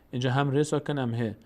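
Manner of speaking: ordinary delivery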